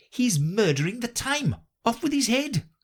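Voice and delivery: hammed-up royal bellow; bawled out. Hatter imitating the Queen bawling at him. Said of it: "bawled out".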